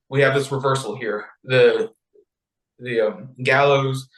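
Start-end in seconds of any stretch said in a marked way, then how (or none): none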